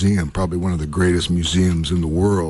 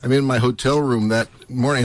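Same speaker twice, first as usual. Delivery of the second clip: usual speaking voice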